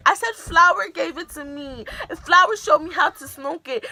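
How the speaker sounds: pitched well up, loud and hurried; high-pitched blurted confession. Retold or imitated. Teen blurting to her parents, high and loud.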